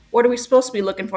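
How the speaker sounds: rushed quote. Retold or imitated. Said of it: "when they're like".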